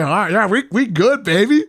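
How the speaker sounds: loud, high hyped-up cheer; excited. Retold or imitated. Vlogger hyping friends over the funnel cake, excited and loud. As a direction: cheer it like the best news ever.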